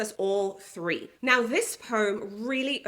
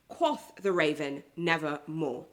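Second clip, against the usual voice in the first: poem reading; 7 semitones lower than usual. Acted. Teacher reading the raven's answer, low and ominous.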